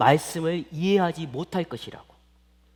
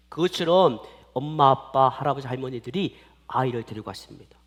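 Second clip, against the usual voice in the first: ordinary talk, nothing marked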